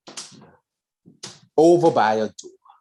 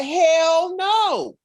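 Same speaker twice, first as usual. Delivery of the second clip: defiant refusal. Retold or imitated. Akron refuses, high.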